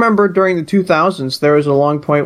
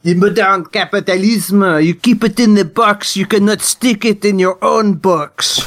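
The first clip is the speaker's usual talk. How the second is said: put-on French accent. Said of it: "pretend to be a French postmodernist".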